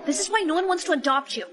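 ordinary talk

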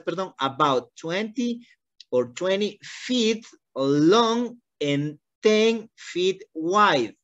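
slow, careful teacher reading; slow model reading. Shown as a demonstration. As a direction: read it slowly for her to copy. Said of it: "Una vez más". (lectura modelo lenta)

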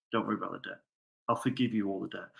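king gently releasing the debtor, soft and low; gentle king. Retold or imitated. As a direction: lay the debt down kindly. King gently forgiving the debt.

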